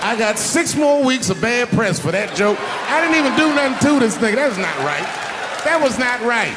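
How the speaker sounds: high, loud indignant protest; loud, high-pitched complaining; mock outrage. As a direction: wail it like you've been wronged. Mock-outraged complaint about bad press.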